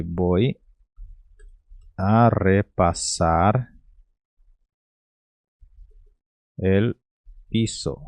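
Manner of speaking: "Voy... a repasar... el... piso", slow, with long pauses between words; pronúncia pausada. Shown as a demonstration. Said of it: "a pronúncia seria mais ou menos assim". (pronúncia pausada, palavra por palavra)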